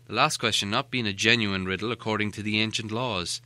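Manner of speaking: usual speaking voice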